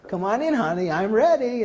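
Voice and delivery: put-on high eager old lady voice; imitating Mary. Patient eagerly welcoming the therapist, high sweet voice. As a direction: chirp it up high, eager to start. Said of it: "every time it's".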